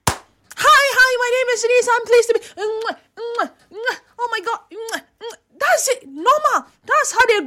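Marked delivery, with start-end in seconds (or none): 0.1-2.4 mock friendly greeter. Mimics a foreign greeting. 2.6-5.4 soft air kisses. Retold or imitated.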